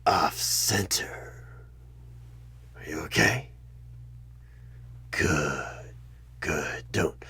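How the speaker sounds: usual speaking voice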